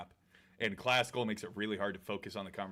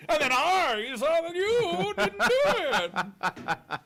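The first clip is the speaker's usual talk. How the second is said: high, loud laughter through the words; cracking up mid-sentence; words breaking into laughs; laughing; 9 dB louder than usual. Real, not acted.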